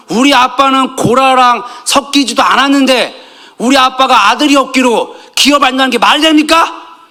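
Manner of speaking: high, forceful protest as the wronged daughters; indignant acted plea for what is owed; confronting demand. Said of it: "막 따지는 겁니다".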